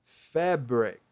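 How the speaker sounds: Fabric said as FEH-brik; teacher modelling Fabric; repeated model word. Shown as a demonstration. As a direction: say Fabric clearly for the class. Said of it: "A pronúncia dela é fabric".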